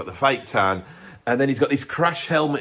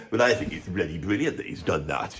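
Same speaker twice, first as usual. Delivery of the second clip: mild mock superfan drone; 9 semitones lower, 5 dB quieter than usual. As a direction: lower and softer, a lightly voiced smug fan. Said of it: "all the Cure fans are gonna go".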